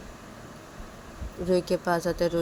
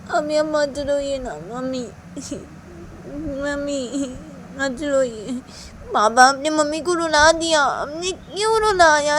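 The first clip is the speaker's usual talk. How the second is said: put-on upset pleading child; 8 semitones higher, 6 dB louder than usual. Child begging father to apologise for making mother cry, upset pleading. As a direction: plead with a wail in the higher voice.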